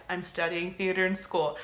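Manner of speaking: ordinary talk, nothing marked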